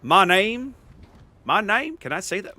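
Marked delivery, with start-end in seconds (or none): 0.0-0.7 badly faked Southern drawl, raised and loud. Shown as a demonstration. Streamer testing a themed introduction, clumsy Southern drawl. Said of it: "I cannot do Southern drawl". 1.4-2.0 badly faked Southern drawl, pitched up. Shown as a demonstration. Streamer retrying the themed introduction, poor Southern drawl. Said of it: "Wow, that sounds terrible".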